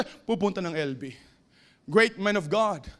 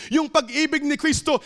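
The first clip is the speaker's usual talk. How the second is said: ordinary talk, nothing marked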